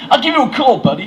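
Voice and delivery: high, loud, chummy American; bad American accent. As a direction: sign off heartily in a hammy American accent. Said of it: "a terrible American accent".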